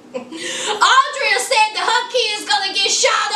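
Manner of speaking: laughing through the words; amused, breaking into laughter. Real, not acted. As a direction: giggle while telling it. Laughing at Andrea's fear.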